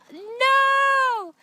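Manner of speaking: long, high loud "no"; hammed-up drawn-out cry of horror; horrified wail. Mother realizing she drank breast milk, horrified wail.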